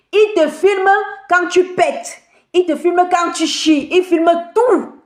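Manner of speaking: high-pitched, mounting indignation; indignant outburst. Real, not acted. Telling viewers what he films of the woman, indignant. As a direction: hammer out each accusation in a high, insistent voice. Indignant listing of intimate filming.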